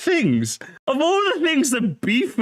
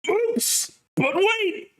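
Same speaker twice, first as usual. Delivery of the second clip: excited gotcha; 12 semitones higher, much slower than usual. Real, not acted. Excited reaction shout.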